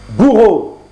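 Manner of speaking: appel fort et aigu; ordre sec. Acted. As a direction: commande d'un ton impérieux. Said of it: "commanda le fils". (le fils du roi appelle le bourreau, d'un ton impérieux)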